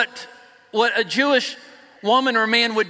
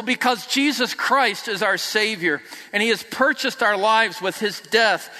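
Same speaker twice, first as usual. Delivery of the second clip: ordinary talk, nothing marked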